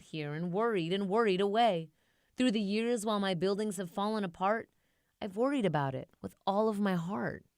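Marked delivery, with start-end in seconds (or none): none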